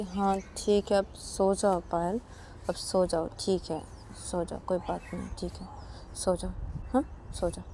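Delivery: gently forgive her and settle her down; soft forgiving. Acted. Ananya forgives, lulls her.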